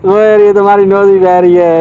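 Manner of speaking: slow, drawn-out affectionate tease; playful teasing. Real, not acted. Adult teasing a crying child about a runny nose, playfully.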